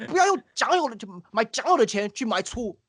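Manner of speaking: high-pitched imitation of a Zhejiang accent; put-on high Zhejiang-accented oratory; Jiang Jingguo. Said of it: "the way he says it pretty well".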